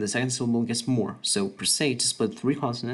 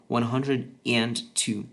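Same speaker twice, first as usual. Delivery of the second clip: very slow, spelled-out reading; slow example; much slower than usual. Shown as a demonstration. Teacher slowly giving the example before converting it. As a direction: lay out each word of one hundred and two slowly. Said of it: "It would be just a hundred two".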